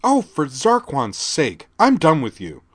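loud, high, slow dismissal; fed up. A narrator's reading. Teen girl dismissing the sergeant, loud and slow. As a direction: dismiss him with contempt. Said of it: "Laura said".

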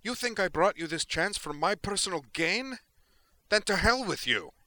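loud, very high, outraged yell; indignant rage, voice way up; furious outburst. A narrator's reading. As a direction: hurl it at them at full pitch. Furious Tali tells admiral off.

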